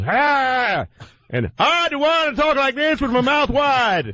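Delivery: high mouth-wide comic voice; Eddie Murphy impression. Host mimicking the actor's goofy voice. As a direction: talk with the mouth stretched wide. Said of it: "when he talks like this".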